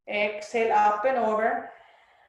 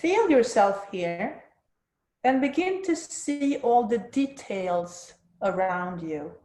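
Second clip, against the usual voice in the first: soft spa whisper. Acted. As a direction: soothe them into stillness, unhurried. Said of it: "let's move into the guided meditation".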